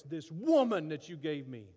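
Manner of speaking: mock resentful blame; bitter. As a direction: spit out the word woman. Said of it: "Now he's angry".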